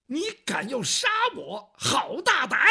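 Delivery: high, loud, indignant squeal; shrill outraged eunuch. Acted. Eunuch outraged at the death threat, high and indignant. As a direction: puff up with outrage.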